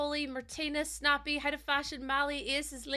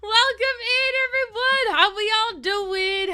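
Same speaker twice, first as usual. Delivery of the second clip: bright greeting; 5 semitones higher, 7 dB louder than usual. Real, not acted. Stream opening greeting.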